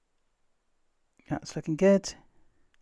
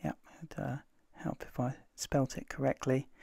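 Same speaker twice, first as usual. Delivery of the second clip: whispering; 9 dB quieter than usual. Real, not acted. Muttered self-correction aside.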